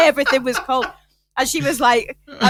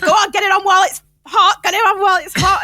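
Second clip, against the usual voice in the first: higher and louder, mock insistent nan; loud urging nan; 9 semitones higher, 6 dB louder than usual. Nan urging the family loudly to eat the gravy. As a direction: push them to eat it, loudly. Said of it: "She was like".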